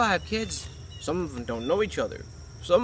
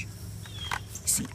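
whisper; 13 dB quieter than usual. Real, not acted.

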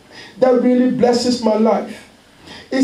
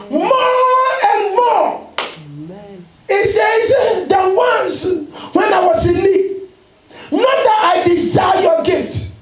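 full-force preaching; 6 semitones higher than usual. Acted. Pastor to church, voicing Paul in full preaching cry. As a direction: thunder the apostle's words.

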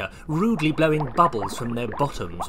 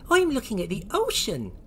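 high-pitched innocent character voice; Oisin; 8 semitones higher, faster than usual. Acted. Oisin answers the naughty Lloyds, in a high innocent voice. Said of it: "said Oisin".

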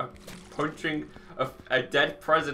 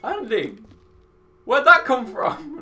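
higher, louder astonished question; startled friends, imitated; 11 semitones higher, 10 dB louder, slower than usual. Friends to a mate who never gets angry, startled. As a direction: mimic mates taken aback by an outburst. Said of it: "they just look at me just like".

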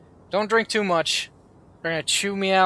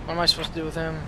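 ordinary talk

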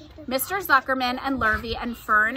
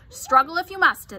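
higher, louder, indignant rat; grumbling rat; 7 semitones higher, 6 dB louder than usual. A narrator's reading. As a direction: lay down terms as a put-upon rat.

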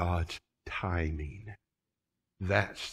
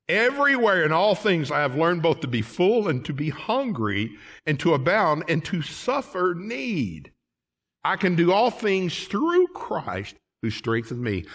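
preaching at full force; 6 semitones higher, 3 dB louder than usual. Acted. Preacher quoting Paul, rising.

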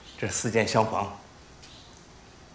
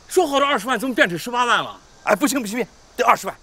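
indignant haggling; 11 semitones higher, 4 dB louder than usual. Acted. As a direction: refuse the offer, rising indignation. Uncle haggling over house price.

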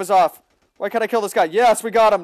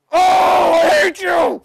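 high, loud furious shout; angry yell; 9 semitones higher, 5 dB louder, much slower than usual. Real, not acted. Gamer rages at a camping player, angry yell. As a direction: let the rage out. Raging at camper.

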